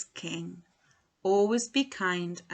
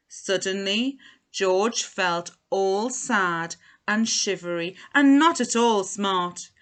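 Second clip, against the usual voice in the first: ordinary delivery, nothing marked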